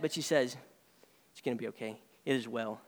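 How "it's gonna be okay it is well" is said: much softer and lower, calm; quiet reassurance. Retold or imitated. Grieving mother tells herself softly all will be well. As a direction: settle it gently, like a hushed promise.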